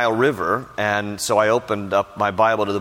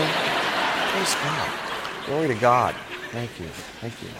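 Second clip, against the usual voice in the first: softer, laughing pious pastor lines; mock pastor piety; 6 dB quieter than usual. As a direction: ham up the holy pastor. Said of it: "I put on a pastor face".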